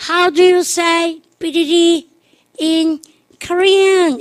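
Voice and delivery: high, slow stage question; imitated pop star addressing fans. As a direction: ask the whole arena, drawn out and high. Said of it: "[name]가".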